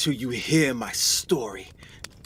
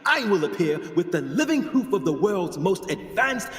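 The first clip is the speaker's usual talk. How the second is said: triumphant showman's announcement; rousing lecture; 5 semitones higher than usual. Acted. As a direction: proclaim it to the whole society. Triumphant end of his lecture.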